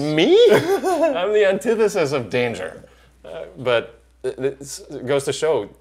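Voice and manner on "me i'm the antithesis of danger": high, loud and laughing disbelief; mock incredulous. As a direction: protest slowly through a laugh, pitch way up. Retelling own disbelief at being called dangerous.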